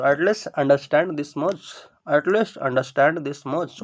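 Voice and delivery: drawn out slowly for students; slow dictation. Shown as a demonstration. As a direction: say it slowly and clearly. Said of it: "समझना के क्या हो जाएगा?". (धीरे-धीरे समझाकर)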